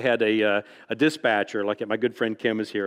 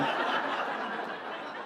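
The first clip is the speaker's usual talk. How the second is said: soft, airless laugh; breathy laugh; 11 dB quieter than usual. Real, not acted. Author laughing at his own punchline, airless laugh. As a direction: huff out a quiet laugh.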